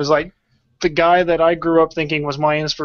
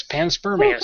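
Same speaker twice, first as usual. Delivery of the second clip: much higher, amazed discovery; as if it can't be a real word; incredulous reading; surprised; slower than usual. Real, not acted. Finds the term on Google.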